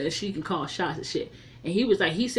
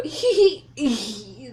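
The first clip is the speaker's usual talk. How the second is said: stoned giggle; 4 semitones higher, slower than usual. Retold or imitated. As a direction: giggle like you're high. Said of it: "she was like".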